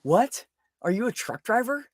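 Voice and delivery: retelling a reply to a truck driver who can't drive stick; retold disbelief. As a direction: sound floored by him.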